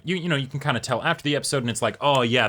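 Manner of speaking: ordinary talk, nothing marked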